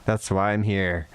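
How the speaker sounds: low through gritted teeth. Acted. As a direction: force it through clenched teeth. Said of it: "says through gritted teeth".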